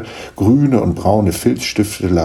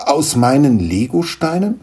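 mildly baffled higher question; 7 semitones higher than usual. Acted. Niklas asks the gnome about his Lego bricks, baffled. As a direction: ask it a little taken aback. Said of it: "fragte Niklas verdutzt".

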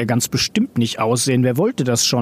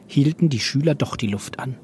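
ordinary delivery

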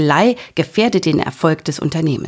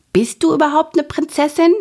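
little dragon voice; 11 semitones higher than usual. A narrator's reading. Little dragon asks princess.

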